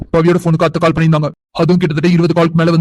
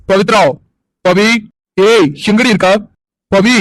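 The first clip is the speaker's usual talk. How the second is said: calling out; 4 semitones higher, 4 dB louder, slower than usual. Acted. Searching the house for Pavi.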